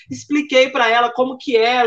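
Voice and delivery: ordinary talk, nothing marked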